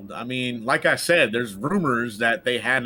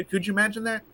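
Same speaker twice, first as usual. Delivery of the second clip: normal talking voice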